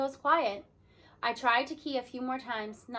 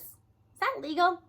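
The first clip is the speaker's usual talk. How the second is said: inquiring; 6 semitones higher, 3 dB louder, slower than usual. Acted. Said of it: "Mateo inquires".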